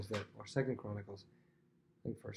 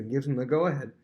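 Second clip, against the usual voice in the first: ordinary delivery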